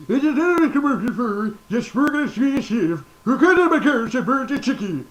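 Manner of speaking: high, loud piano-dog voice; Rolf. Pretend. Doing Rolf for the audience, a high loud dog voice. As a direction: play a goofy showman dog. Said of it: "you have Rolf".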